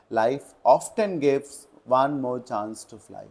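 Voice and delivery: very slow, each word separate; slow dictation. Shown as a demonstration. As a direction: read the test sentence for the class. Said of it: "rereading exam sentence". (बहुत धीमे वाक्य पढ़ना)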